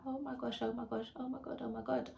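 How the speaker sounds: overwhelmed by the newborn, half sobbing; teary disbelief. Retold or imitated. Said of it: "I just break down crying and I'm like".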